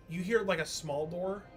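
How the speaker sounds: usual speaking voice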